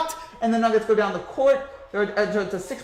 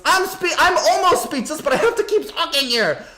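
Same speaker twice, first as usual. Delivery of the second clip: stunned; 7 semitones higher than usual. Real, not acted. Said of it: "Frankie, I'm speechless".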